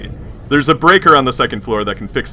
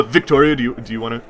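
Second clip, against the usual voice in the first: ordinary talk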